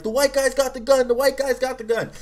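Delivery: high-pitched imitation of yelling cops; imitated yelling. Cops warning each other about the gun, imitated yelling. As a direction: sound the alarm like a cop, mock-yelled. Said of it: "the cops just kept yelling".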